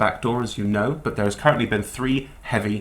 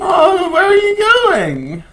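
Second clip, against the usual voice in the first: high, slow wail after departing customers; hammed-up high wailing character; distressed bookseller; 7 dB louder, slower than usual. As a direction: call out plaintively in a very high voice.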